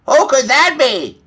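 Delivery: high, loud startled hen voice; wondering hen. A narrator's reading. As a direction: ask in a high-pitched hen voice.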